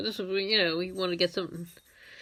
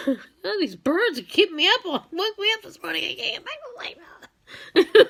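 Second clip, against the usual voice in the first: high whiny grumbling; mock-cranky, building higher to a whine; cranky old person; 9 semitones higher than usual. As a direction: complain like a tired middle-aged grump. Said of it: "'Cause I'd be like".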